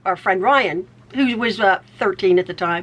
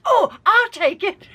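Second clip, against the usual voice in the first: put-on eager teen grab; 10 semitones higher than usual. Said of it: "And Ryan goes".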